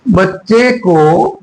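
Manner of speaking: बच्चे को at half speed, each word stretched; slow dictation. Shown as a demonstration. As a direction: draw out बच्चे को for people writing it down. Slow repeat for students writing.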